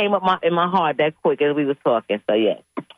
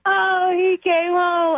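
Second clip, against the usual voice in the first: high, slow, crying with joy; half-sob the words in relief; tearful joy; 11 semitones higher, much slower than usual. Retold or imitated. Grandma greets her returned lizard, high tearful joy.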